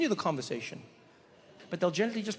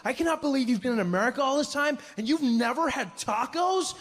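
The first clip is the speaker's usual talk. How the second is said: excited disbelief; 6 semitones higher than usual. Retold or imitated. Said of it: "he jumped up and he was like".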